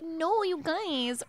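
high, slow, prim little protest; a mock goody-goody whine; innocent girl. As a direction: play the timid good girl. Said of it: "the innocent one being like".

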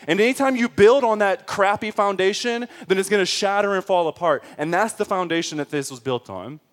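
loud, high-pitched preaching; emphatic sermon at high intensity; forceful preaching. Real, not acted.

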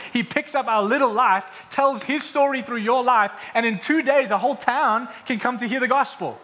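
full-force revival preaching. Acted.

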